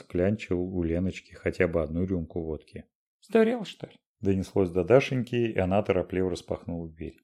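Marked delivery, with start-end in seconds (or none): 3.2-3.9 high startled cry; shocked shriek. A narrator's reading. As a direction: yelp it in alarm. Lenochka shouted exclamation.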